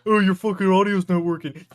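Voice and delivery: loud, high whiny viewer complaint; mocking annoyed viewer. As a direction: shout it like an irritated chat viewer. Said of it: "people go".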